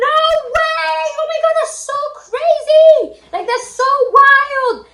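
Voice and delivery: very high, slow, drawn-out squeal; excited screaming. Retold or imitated. Extra best friend hearing of first kiss, screaming. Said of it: "I was, like, just screaming".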